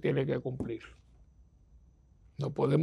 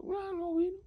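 very high, squeaky tattling voice; exaggerated mocking falsetto; mock falsetto tattle; 5 dB quieter than usual. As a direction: squeak it like a gossiping churchgoer. Mocking gossip about absentee.

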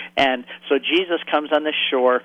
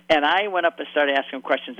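ordinary talk, nothing marked

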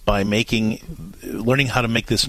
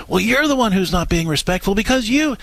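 mock angry husband; 9 semitones higher than usual. Voicing husband's angry retort.

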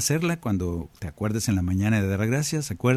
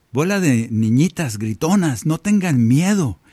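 slow, louder, teasing scold; mock scolding Jesus; 4 semitones higher, 5 dB louder, slower than usual. Jesus chiding the screaming disciples, slow and loud. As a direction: tease the frightened disciples, drawn out. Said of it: "Jesús le dice".

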